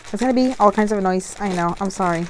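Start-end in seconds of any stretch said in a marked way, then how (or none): none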